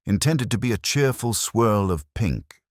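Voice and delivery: normal talking voice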